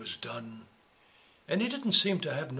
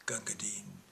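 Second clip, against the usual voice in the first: soft unvoiced whisper; hushed closing words; whispered tribute; 5 semitones lower, 11 dB quieter than usual. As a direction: breathe the name with reverence. Poem's tender final line.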